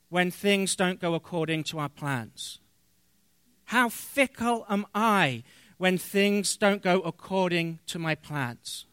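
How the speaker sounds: normal talking voice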